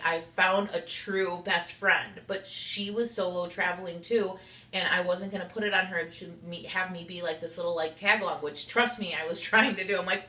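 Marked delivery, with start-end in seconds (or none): none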